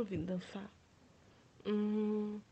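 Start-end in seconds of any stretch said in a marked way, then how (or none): none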